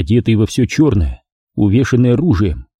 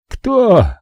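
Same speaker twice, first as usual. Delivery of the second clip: high nervous squeak; 11 semitones higher than usual. Acted. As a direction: yelp the question in panic. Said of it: "нервно переспросил мафиози".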